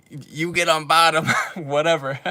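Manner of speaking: giggling through the words; laughing. Real, not acted. Speaker describing an eager girl, laughing. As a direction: laugh while saying it.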